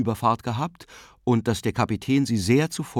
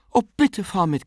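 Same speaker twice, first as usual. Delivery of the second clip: high, louder, eager cry; excited plea; 11 semitones higher, 5 dB louder than usual. A narrator's reading. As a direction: burst out pleading eagerly. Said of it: "rief sie".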